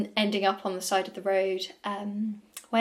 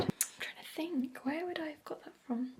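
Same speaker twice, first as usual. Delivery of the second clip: whispering; 6 semitones higher, 13 dB quieter than usual. Real, not acted. Musing quietly to herself.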